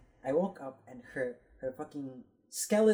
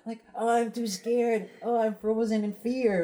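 high, hammed-up frightened whimper; mock scared; 8 semitones higher, 4 dB louder than usual. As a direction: act terrified, half laughing. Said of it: "I like".

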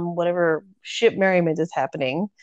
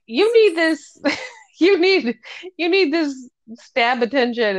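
much higher, giggling through it; mock doting nurse; 8 semitones higher than usual. Anne insists on dressing his stab wound, high and giggly. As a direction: coo it insistently, half laughing.

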